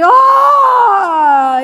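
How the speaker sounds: loud, high gasping Ah; an amazed shout at the sight; awed cry. Retold or imitated. Imagined believer seeing the angel, a loud high gasp.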